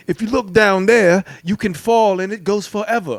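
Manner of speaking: mock a British survival host's accent; Bear Grylls. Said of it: "that's a terrible accent".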